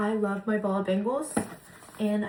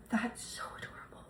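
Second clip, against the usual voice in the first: awed whisper; 12 dB quieter than usual. Real, not acted. Whispered awe over gift.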